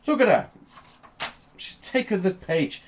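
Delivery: high, loud outraged complaint; indignant Doctor. Acted. Doctor finding a page torn from his notebook, indignant. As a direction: exclaim it in a high-pitched, affronted character voice.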